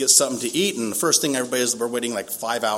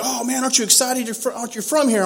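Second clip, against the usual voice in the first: much higher, eager voice of others; higher, gushing "aren't you excited"; mock excited friends; 11 semitones higher than usual. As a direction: imitate people hyped for him. Everyone excited for him.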